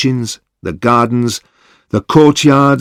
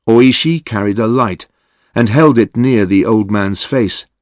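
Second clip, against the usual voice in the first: plain reading voice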